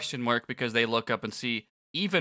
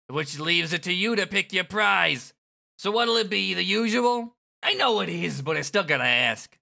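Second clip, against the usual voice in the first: brash game announcer; 7 semitones higher, 5 dB louder than usual. Acted. Kubo taking control of the scene, brash. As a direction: call it out like a cocky emcee.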